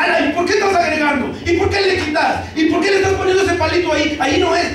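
high, fast, exasperated scolding; scolding teacher. Retold or imitated. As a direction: mimic an impatient teacher scolding a child. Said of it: "no se enoje y no le diga". (maestra regañona, aguda y rápida)

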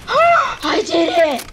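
loud, high, slow victory yell; real elated slow cheer; triumphant shout. Real, not acted. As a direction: scream the win out. Wins a hard fight.

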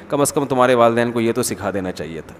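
honey-coated taunt; sweetly barbed. Retold or imitated. Even nicer mother-in-law slipping in a soft taunt. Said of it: "और ज़्यादा नेक होगी तो कहेगी".

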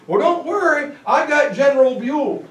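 high, cocky reassurance; breezy Wallace. Retold or imitated. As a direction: brush it off smugly.

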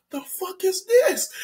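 high, slow, softly stunned disbelief; bewildered. Retold or imitated. Kid holding the bag outside, bewildered. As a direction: draw it out in high-pitched disbelief. His shocked reaction outside store.

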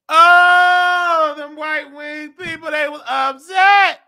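high, loud, heated outburst; outraged shout. Acted. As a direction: blast out the outrage at those people. Outraged reaction to mayor photo.

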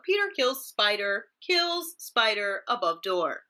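slow, even anchor read landing on "door"; slow down and hit "door"; emphasis on door. Shown as a demonstration. Anchor showing where the emphasis falls, slow. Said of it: "Door is the emphasis".

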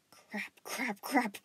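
frantic, rising panic; panicked cursing. A narrator's reading. Panicking boy curses at his ringing phone. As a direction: mutter then blurt in rising panic. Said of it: "he yelled through his thoughts".

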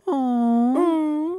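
high cooing aw; baby-talk coo. Acted. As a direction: coo like at a puppy. Cooing about puppies.

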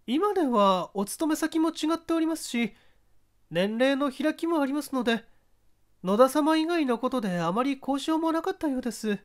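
voiced lady witness. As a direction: speak up high as a courteous widow. Mrs. Okawa's answer continued.

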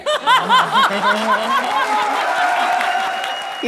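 high, long rolling laughter. Real, not acted. Inspector laughs at the snack excuse, high and long. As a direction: keep laughing helplessly.

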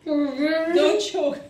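usual speaking voice

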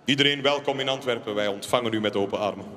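ordinary talk

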